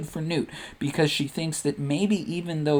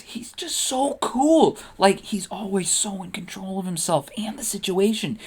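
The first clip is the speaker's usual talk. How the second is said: smitten gushing; 4 semitones higher than usual. Acted. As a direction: gush like a lovestruck fan. Padme gushes over Newt to Sabe.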